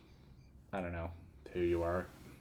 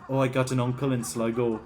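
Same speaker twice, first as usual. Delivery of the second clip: louder mock-Irish lilt; Irish accent; 3 semitones higher, 5 dB louder than usual. Gamer repeating a silly practice phrase, Irish accent. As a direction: toss it off in stage Irish. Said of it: "stupid phrases like".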